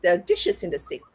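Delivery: usual speaking voice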